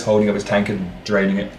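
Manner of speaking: ordinary talk, nothing marked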